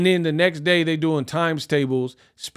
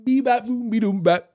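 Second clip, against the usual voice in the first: put-on baby babble; 6 semitones higher than usual. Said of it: "he was like".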